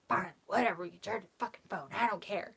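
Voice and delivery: quiet resentful mutter; grumbling give-in. Retold or imitated. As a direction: grumble it under your breath, fed up. Retold giving in, annoyed.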